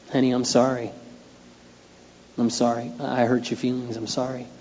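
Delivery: whisper-soft sorry to a hurt wife; tender apology. Retold or imitated.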